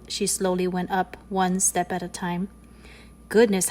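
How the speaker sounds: plain reading voice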